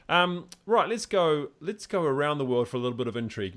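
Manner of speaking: rally the listeners for a new topic; energetic segue. Real, not acted. Host kicking off a world news segment, bright loud energy.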